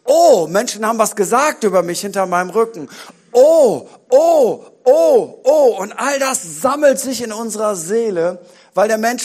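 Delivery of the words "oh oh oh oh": high, loud drawn-out oh of lament; mock-anguished loud ohs; loud groaning ohs. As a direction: groan each oh out loud in despair. Preacher groans over life's hurts.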